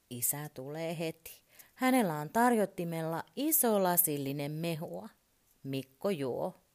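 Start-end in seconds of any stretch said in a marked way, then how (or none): none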